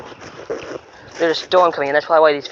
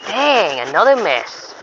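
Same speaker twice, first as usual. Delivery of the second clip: frustrated; 5 semitones higher, much slower than usual. Real, not acted. Fish gets away.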